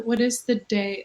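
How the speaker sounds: ordinary talk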